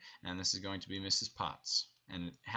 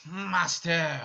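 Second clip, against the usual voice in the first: high, louder joyful call; Lumiere's happy cry; 7 semitones higher, 8 dB louder than usual. Shown as a demonstration. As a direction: call out to your master with joy. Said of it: "And then Lumiere says".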